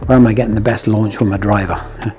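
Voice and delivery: ordinary talk, nothing marked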